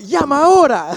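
loud, high mock Spanish outburst; mock blathering. Imitating the pastor shaking heads, loud mock blathering. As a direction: belt out pretend Spanish like a fevered preacher. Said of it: "he starts fucking blathering some shit".

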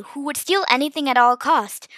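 plain reading voice

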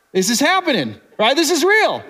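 disbelieving joy walking on water; excited. Retold or imitated. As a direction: play Peter thrilled as it works. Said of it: "there had to be excitement".

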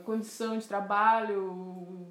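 ordinary talk

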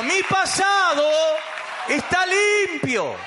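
slow, high, loud proclamation; rising preacher's cry of cleansing; call and response. Shown as a demonstration. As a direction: shout it out for the church to repeat. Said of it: "Decí conmigo".

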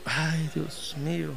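slow, soft "Ay, Dios mío"; mild pitying lament; pitying sigh. Retold or imitated. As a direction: sigh over the poor workers. Pitying the workers.

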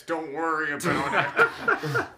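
slow, loud laughing brush-off. Real, not acted. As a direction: laugh it off slowly. Joking cover-up.

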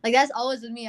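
ordinary talk

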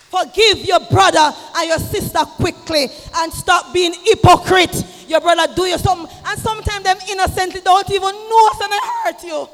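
loud, high shouted rebuke; preacher shouting a rebuke; fiery preaching. Acted. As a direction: hammer the point home to the church.

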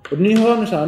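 ordinary talk

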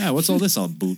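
high, hammed-up Canadian accent; mock Canadian with "about" turned into "a boot". Random character barging into a movie, mock Canadian accent. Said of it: "one random character will come in and be like".

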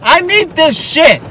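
hammed-up mom taking the phones; friend's mom snatching the phones at a sleepover, mock outraged; mock shrill mom. Voicing the friend's mom, acted.

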